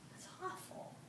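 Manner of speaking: whispered in shock; a shocked hushed aside. Acted. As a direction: breathe it out, aghast. Sympathetic gasp at the news.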